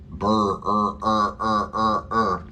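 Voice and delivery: "burr... er, er" as a held r vowel; drawn-out r sound. Shown as a demonstration. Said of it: "If you're wondering how to say birds".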